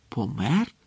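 hushed, rising question of shock; stunned. A narrator's reading. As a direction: echo it in stunned disbelief. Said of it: "приголомшено перепитав Гаррі". (приголомшене перепитування)

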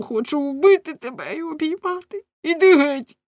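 high, loud, breaking into a shout; anguished fury. A narrator's reading. Husband torn between killing and embracing, anguished shout. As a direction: let torn feelings burst out high and loud. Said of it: "плачучи і скригочучи зубами".

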